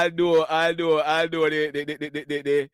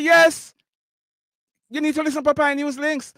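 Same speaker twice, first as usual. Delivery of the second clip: eager fan; 8 semitones higher than usual. Retold or imitated. Restaurant fan urging the host to listen, excited insistence. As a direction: push it like an excited fan selling the show.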